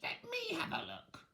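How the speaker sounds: high, whiny witch voice; whining witch. Acted. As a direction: whine it like a shrill old witch. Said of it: "whined the other sister".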